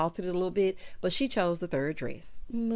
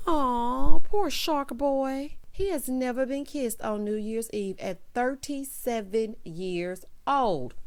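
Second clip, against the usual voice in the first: mock pity; 4 semitones higher, 4 dB louder, slower than usual. Real, not acted. Pities the shark boy.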